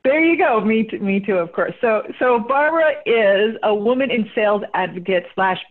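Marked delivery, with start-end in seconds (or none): none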